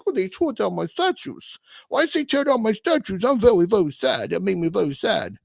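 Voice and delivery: high, whimpering mock-sad devil voice; whiny sad Satan. Satan sulking about his torn-down statue, whiny and high. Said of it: "now Satan's like".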